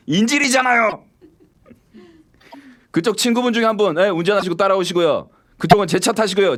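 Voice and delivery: high, loud mock threats through laughs; menacing kidnapper act. Hammed-up threats, ordering the other driver into his car. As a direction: play the hostage-taker with a grin. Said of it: "내가 막 으르면서 막 이렇게 분위기 험상궂게".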